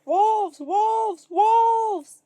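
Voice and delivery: repeated high, loud yells; desperate repeated shout. A narrator's reading. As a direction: keep screaming for help. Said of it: "Jack shouted".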